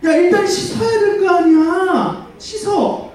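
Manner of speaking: high, slow scolding mother voice; scolding mom. Acted. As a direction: order the dirty kid to go wash, from up high. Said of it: "이렇게 얘기하실까, 엄마가". (아이에게 씻으라고 하는 엄마, 높고 느린 잔소리)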